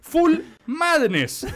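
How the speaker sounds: slow, drawn-out pronouncement; slow dramatic verdict. Real, not acted. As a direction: stretch it out for effect.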